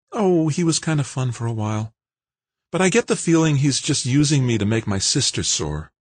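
put-on light, high girlish voice; young woman voice. A narrator's reading. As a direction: voice Kimberly bright and young. Kimberly voiced by narrator.

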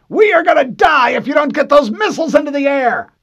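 very high, loud desperate yell; desperate shout. A narrator's reading. Harlan yelling at Walker to fire the missiles. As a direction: scream it in panic.

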